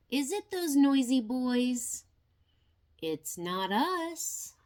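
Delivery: ordinary delivery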